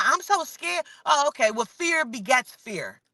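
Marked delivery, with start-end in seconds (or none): 0.0-0.9 mock scared. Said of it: "Everybody tell me".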